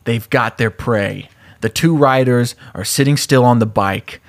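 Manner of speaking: ordinary delivery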